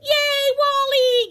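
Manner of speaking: very high, loud cheer. Acted. Gilbert cheering for Wally, high and loud. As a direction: cheer him on.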